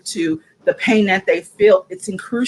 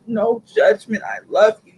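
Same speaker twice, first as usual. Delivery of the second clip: ordinary talk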